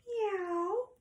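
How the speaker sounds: high, drawn-out meow; cat meow. Acted. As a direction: imitate a cat way up in pitch. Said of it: "And a cat says".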